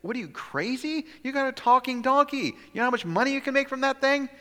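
high, fast, loud disbelief; can't believe he'd waste a talking donkey; incredulous bystander. Pretend. Bystander scolds Balaam.

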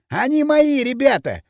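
high, loud crowing; triumphant boast. A narrator's reading. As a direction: exult in new power. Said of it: "торжествующе расхохотался Пакля".

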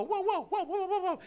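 high, playful woof-woof with a laugh; mock dog barking. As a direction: bark like a silly little dog. Pastor imitates a dog barking.

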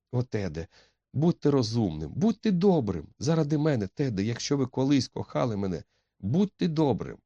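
put-on high-pitched, urgent begging young woman; desperate pleading. A narrator's reading. Etti begs Ted to be kind, high urgent pleading. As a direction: beg him, voice climbing high.

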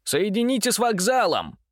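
loud, high call down the line; shouting into phone. A narrator's reading. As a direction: yell it into the receiver. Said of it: "заорал Митя в трубку".

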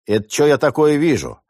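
rising indignant challenge, pitched high; getting angry. Acted. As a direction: bristle up as the gang leader. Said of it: "сходу начал заводиться главарь".

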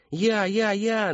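put-on high, excited kids' cries; squealed volunteering, pitched right up; children yelling eagerly. A narrator's reading. Children compete to help the clown, yelling. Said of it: "наперебой завопили дети".

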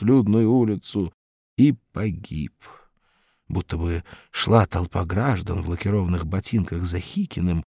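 usual speaking voice

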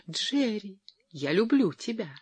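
high, gushing declaration of love; thrilled exclamation. A narrator's reading. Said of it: "воскликнула Джоан".

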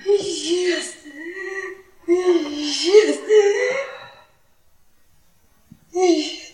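breaking voice, barely holding together; stunned tearful repeat. Acted. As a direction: echo it in shock as tears come. Hikâyenin ifşasıyla sarsılan kadın.

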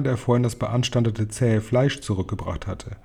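ordinary delivery, nothing marked

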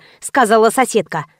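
usual speaking voice

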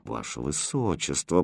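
low, hushed outrage; indignant hiss. Acted. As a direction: scold under the breath. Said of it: "прошипела возмущённая гофмистерина". (возмущённо шипя)

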